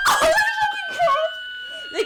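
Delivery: shrieking through a laugh, drawn out and very high; a horrified laughing yelp at her own slip; mortified shriek. Retold or imitated. Woman recalling her date blunder, mortified laughing shriek. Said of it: "and I go".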